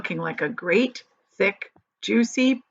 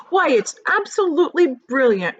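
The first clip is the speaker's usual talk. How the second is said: put-on excited old grasshopper cry, a touch louder and higher; cried; 7 semitones higher, 6 dB louder than usual. A narrator's reading. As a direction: exclaim it with delight, lightly voiced. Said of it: "cried the old green grasshopper".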